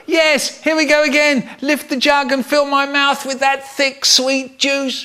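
high, sustained excited build; greedy glee. Acted. Boy lifting the syrup jug, greedily excited. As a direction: gloat before the big gulp.

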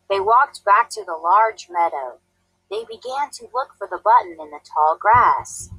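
plain reading voice

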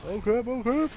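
high, loud panicked yelp; panicking as enemies close in. Real, not acted. Panic during firefight.

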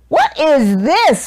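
high, slow, indignant demand; outraged roar. A narrator's reading. Duke tasting the bad roll, outraged roar. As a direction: roar it in outrage, stretching the words. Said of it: "roared the Duke".

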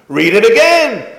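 high, loud teacher command; mock stern order. Rebbe ordering the boy to reread, loud.

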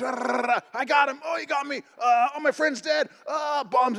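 high, hammed-up battlefield panic; soldier in the trenches. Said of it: "the guys in the trenches".

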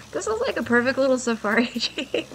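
ordinary talk

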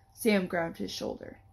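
plain reading voice

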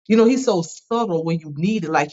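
ordinary talk, nothing marked

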